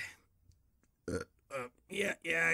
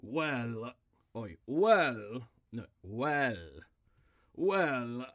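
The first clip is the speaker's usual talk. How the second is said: ordinary delivery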